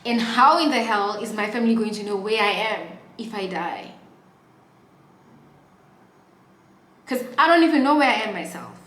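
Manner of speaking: loud, high panicked rant, close to shouting; frantic outcry. Retold or imitated. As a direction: wail it out like you're lost and scared. Panicked lost at night.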